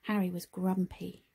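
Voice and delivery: usual speaking voice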